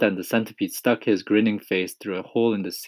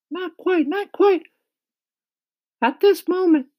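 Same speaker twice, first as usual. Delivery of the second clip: high-pitched, loud character voice; kindly ladybug; 7 dB louder than usual. A narrator's reading. Ladybug telling James of their departure, high, loud voice. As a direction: speak up high as an eager ladybug.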